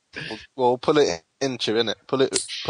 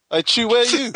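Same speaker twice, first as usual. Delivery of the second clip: pitched well up, calling across the room; high "hey, Chew" call; 12 semitones higher than usual. Real, not acted. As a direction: shout for your mate to answer. Calling out to a friend.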